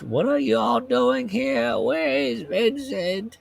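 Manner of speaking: high, slow croaking woman's voice; a high slow croak, startled and accusing; croaking old woman. Acted. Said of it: "a woman's voice croaked".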